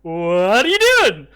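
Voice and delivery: loud goblin outburst; startled goblin yell. Acted. Goblins yell at the intruding party, startled and loud. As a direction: blurt it out loud as a caught goblin.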